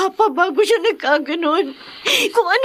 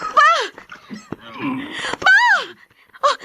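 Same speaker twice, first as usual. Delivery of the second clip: panicked crying out; 6 semitones higher than usual. Acted. Daughter finding her father bleeding, screaming in panic. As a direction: call out again and again in terror.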